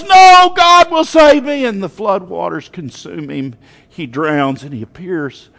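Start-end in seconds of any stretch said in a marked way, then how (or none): 0.0-1.7 very high, loud refusal; stubborn faith at top volume; defiant shout. Acted. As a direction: shout down the rescuer with full conviction. Acting final refusal of rescue.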